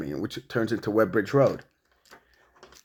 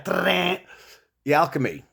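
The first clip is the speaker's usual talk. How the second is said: usual speaking voice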